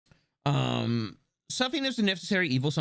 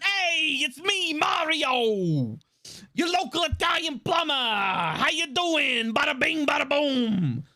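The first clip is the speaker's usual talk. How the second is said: put-on over-the-top Italian accent; 7 semitones higher, slower than usual. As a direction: go big on the Italian accent, lean all the way in. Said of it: "he's not even leaning into it".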